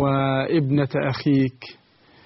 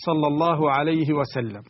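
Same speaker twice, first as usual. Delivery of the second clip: ordinary delivery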